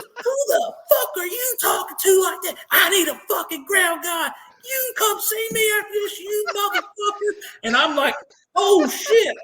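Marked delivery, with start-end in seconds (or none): 0.0-7.3 furious sergeant major yell. Retold or imitated. Sergeant major screaming at a lance corporal who mouthed off. 8.5-9.4 caught off guard. Retold or imitated. Caught by the sergeant major.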